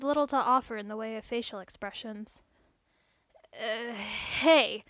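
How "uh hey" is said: halting, unsure start; stammering. A narrator's reading. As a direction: stumble over the greeting. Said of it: "he stammered".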